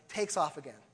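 usual speaking voice

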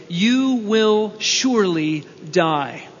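"you will surely die" drawn out slowly, each word weighed; slow and solemn. Shown as a demonstration. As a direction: lay the verdict down slowly and gravely. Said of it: "The Hebrew is".

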